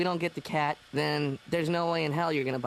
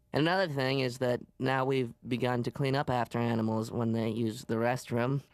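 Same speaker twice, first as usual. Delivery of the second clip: ordinary talk, nothing marked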